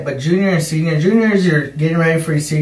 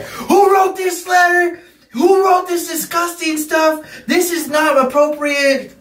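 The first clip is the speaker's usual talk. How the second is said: imitated angry yell, pitched way up; yelling teacher; 12 semitones higher than usual. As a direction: mimic a teacher yelling, high and drawn out. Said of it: "he's just yelling".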